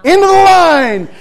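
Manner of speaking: slow, high, booming holler; long loud bellow over the crowd; airline lady calling out. Retold or imitated. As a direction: stretch it out like a queue marshal yelling. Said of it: "lady's standing there saying".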